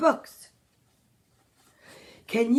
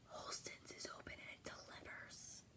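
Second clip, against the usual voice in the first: whispering; 33 dB quieter than usual. Real, not acted. Whispered aside to listener.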